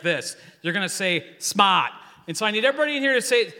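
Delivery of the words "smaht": dropped-R East Coast "smart", punched loud; big East Coast twang on one word; East Coast accent. Shown as a demonstration. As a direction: say it like an Eastern Seaboard local, "smaht". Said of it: "say it like they do".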